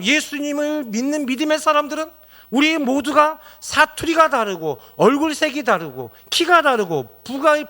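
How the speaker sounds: loud, high impassioned sermon; a pastor's fiery call from the pulpit; fervent preaching. Acted. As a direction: preach it out with full force, rising high. Preaching at full force.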